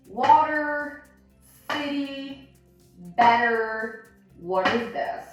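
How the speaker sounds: water, city, better with T flapped like a D. Shown as a demonstration. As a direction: soften each T to a quick D. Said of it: "So we would say".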